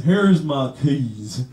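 low, dragging Alabama drawl with long pauses; deep, slow mock-Alabama accent. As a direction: draw out each word like a slow Alabaman. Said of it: "the Alabama guy".

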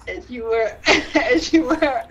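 words broken by laughter; laughing. Real, not acted. Laughing too hard to speak.

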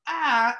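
high little yelp of fright; a quick startled gasp; mock gasp. Recalling the roach fright, a short high yelp. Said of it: "the emotion of".

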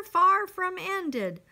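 usual speaking voice

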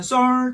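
"썰" held on a level pitch; first tone. Shown as a demonstration. Teacher recalling the first-syllable tone, "썰" level. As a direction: sustain "썰" steadily. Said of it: "톤이 되겠죠".

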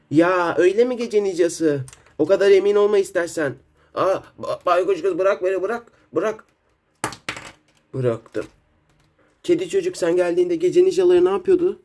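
put-on loud, confident child hero; bold kid hero voice. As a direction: voice a brave young hero. Hero kids confront villains.

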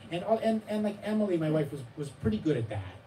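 normal talking voice

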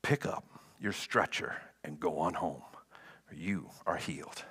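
soft unvoiced whisper of authority; hushed command. A narrator's reading. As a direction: breathe the healing command in a whisper. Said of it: "he commanded".

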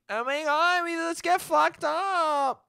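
high, slow, hammed-up party girl squeal; mock the weekend partiers, up high and drawn out; mock party hype. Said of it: "everyone's like".

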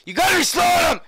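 loud high shout; frustrated shout. Real, not acted. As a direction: shout it at the screen. Gamer shouting at game.

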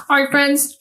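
usual speaking voice

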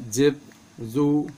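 normal talking voice

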